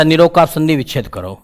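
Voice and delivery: ordinary talk, nothing marked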